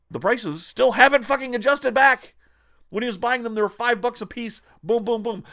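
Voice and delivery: loud, high heated rant; angry rant. Real, not acted. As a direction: rant hard, fed up. Ranting at market cornering.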